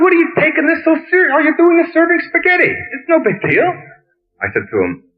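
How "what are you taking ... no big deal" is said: much higher, fast, teasing quoted self; mock exasperation. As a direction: toss it off high and quick as if it's nothing.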